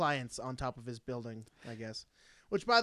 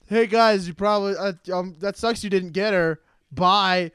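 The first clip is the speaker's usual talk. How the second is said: mocking the boss; 3 semitones higher, 6 dB louder than usual. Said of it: "why wouldn't they be like".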